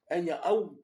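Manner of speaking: nya aou said high and loud, drawn nyaa-ou; Kanak phrase demo. Shown as a demonstration. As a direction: call out nya aou as the example to avoid. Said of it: "Au lieu de dire".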